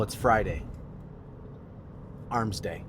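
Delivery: ordinary talk, nothing marked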